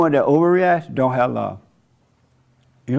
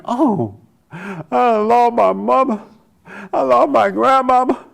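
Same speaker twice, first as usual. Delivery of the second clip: slow, higher, hammed-up wailing over a lost mama; mock grieving wail; 8 semitones higher, slower than usual. Mocking grieving relatives at a press conference, slow hammed-up wail. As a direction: lay the grief on thick, mockingly.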